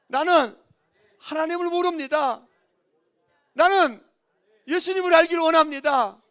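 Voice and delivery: very loud, forceful declaration; call-and-repeat preaching. Real, not acted. Preacher leading a confession of not knowing Jesus, loud preaching. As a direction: shout each phrase out for the congregation to repeat.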